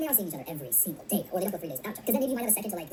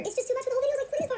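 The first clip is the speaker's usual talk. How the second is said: exasperated complaint. Real, not acted. Host fed up with the mirroring, high exasperated complaint. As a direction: complain in a strained high pitch.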